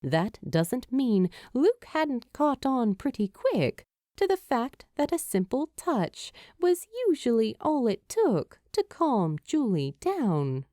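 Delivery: ordinary delivery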